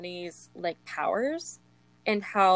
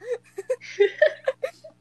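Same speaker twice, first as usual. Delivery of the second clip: high laughing fit; high laughter; 7 dB louder than usual. Real, not acted. Laughing at the quote.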